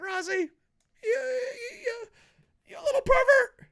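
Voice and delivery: very high, strained squeal; hammed-up stammering accusation. Reviewer imagining confronting Rozzy, hammed-up stammering accusation.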